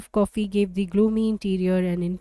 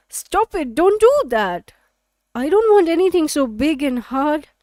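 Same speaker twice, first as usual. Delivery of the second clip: high, louder mock cry of protest; hammed-up shocked refusal, pitched up and loud; playful mock protest; 6 semitones higher, 5 dB louder than usual. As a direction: squeal it like a pretend scandalized girl. Midori acts out fantasy protest.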